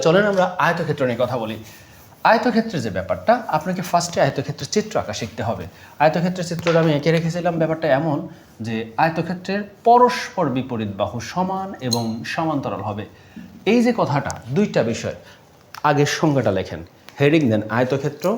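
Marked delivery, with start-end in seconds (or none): none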